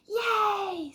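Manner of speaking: high, loud excited cheer; shouty yay. Acted. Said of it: "said shouty, shouty Arthur".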